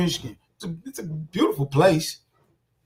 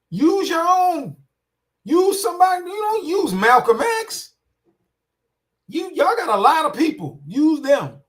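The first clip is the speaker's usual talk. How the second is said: indignant outburst; 9 semitones higher, 3 dB louder than usual. Real, not acted. Ranting at people who quote Malcolm X.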